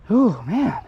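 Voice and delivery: winded, puffing after riding; breathless from kicking the scooter; out of breath. Real, not acted. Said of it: "I'm out of breath".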